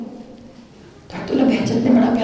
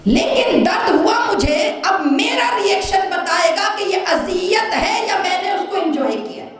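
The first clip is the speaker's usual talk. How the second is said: fervent shout; 7 semitones higher than usual. Real, not acted. Preacher building to the lesson on pain, shouted. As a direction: hammer the point at the crowd.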